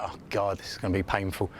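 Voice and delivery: low, resigned aside; mild dread. Retold or imitated. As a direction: sink into a low, weary groan of words. Voices writer dreading producers' conflicting feedback.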